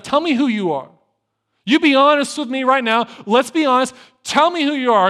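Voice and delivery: a preacher voicing God, insisting; demanding God. God demanding honesty from Jacob.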